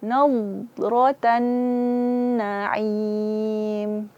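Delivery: "Nadratan na'im" very slow, the nun hummed long through the nose; full nasal ghunnah. Shown as a demonstration. Teacher shows the perfect ghunnah for the class, very slow. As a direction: hold the nasal hum on the doubled nun. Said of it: "Maka sempurnakan gunnahnya".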